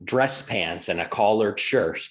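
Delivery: usual speaking voice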